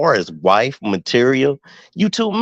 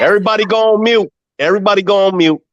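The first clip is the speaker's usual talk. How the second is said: raised call to mute; 9 semitones higher, faster than usual. Real, not acted. Host calling for order.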